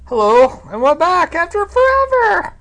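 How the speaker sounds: high, loud announcer greeting; excited announcer. Acted. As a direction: boom it out like a show host. Show opening greeting.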